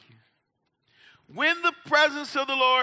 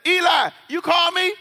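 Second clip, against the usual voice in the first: put-on boy calls out louder and higher; calling out; 6 semitones higher, 4 dB louder than usual. Young Samuel calls out to Eli loudly. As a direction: shout the question across the house.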